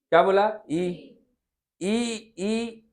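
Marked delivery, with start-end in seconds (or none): 1.8-2.9 ई held long and soft to show the tongue tip; ई sustained as a tongue-position demo; demo vowel. Shown as a demonstration. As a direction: stretch ई out so they feel the tongue forward. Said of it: "तो एक ठो याद रखो ई".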